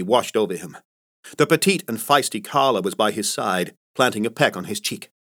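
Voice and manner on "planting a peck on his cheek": ordinary delivery, nothing marked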